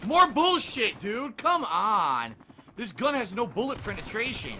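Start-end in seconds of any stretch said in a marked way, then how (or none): 0.1-2.4 real exasperated outburst; angry complaint. Real, not acted. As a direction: yell it, fed up. Angry at game.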